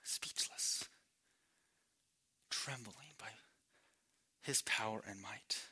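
barely audible, low and reverent; hushed, as if struck speechless; awed hush. Acted. Preacher picturing us speechless before God's might, awed hush.

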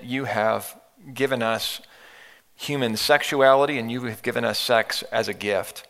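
calm, earnest prayer voice. Acted. Leads the congregation in prayer.

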